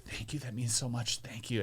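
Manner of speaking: quiet, humble thank-yous; soft fake gratitude. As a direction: brush off criticism with soft thanks. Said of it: "he's like".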